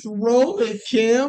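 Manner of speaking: high mock urging; lightly voiced, pleading sidekick; Ron's plea. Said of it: "And Ron says".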